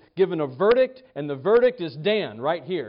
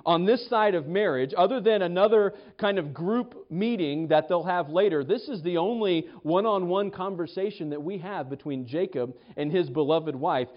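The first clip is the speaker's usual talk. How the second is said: ordinary delivery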